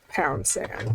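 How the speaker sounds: breathy whispered Pound sand, falling. Retold or imitated. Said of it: "That's what I think the judge is gonna tell her".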